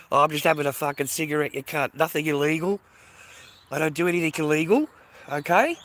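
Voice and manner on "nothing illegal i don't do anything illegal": ordinary talk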